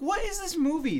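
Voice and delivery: high, incredulous; baffled. Retold or imitated. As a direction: ask it in disbelief. Quoting own baffled reaction.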